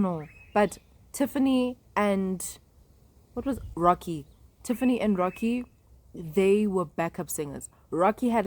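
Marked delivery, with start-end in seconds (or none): none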